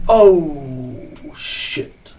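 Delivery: very slow, drawn-out reaction; long, dragged-out mock realization; mock dismay. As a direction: stretch it out as if watching a disaster unfold. Drawn-out reaction in retold crash.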